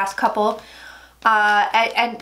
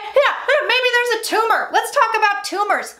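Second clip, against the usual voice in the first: mocking; 10 semitones higher than usual. Mocking doctors' tumor suggestion.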